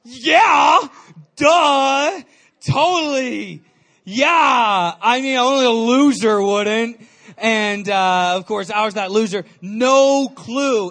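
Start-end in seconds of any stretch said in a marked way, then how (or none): 0.0-7.0 overselling it to the interviewer; fake confidence. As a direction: bluff like you know it all. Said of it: "I go".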